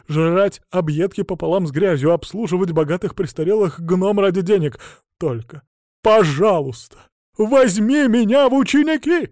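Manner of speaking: high, loud, slowed desperate plea; desperate begging. Acted. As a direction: throw yourself at his feet with the voice. Bard grovelling, begging.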